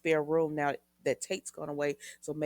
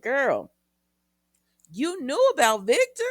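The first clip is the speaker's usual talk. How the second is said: loud, high-pitched disbelief; girlfriend-style outburst of disbelief; incredulous exclamation; 9 semitones higher, 11 dB louder than usual. Acted. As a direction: call her out, loud and incredulous. Host's incredulous aside to Maggie.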